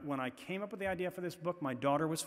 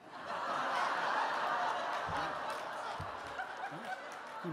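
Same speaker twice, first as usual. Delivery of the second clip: real laugh. Real, not acted. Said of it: "That's terrible".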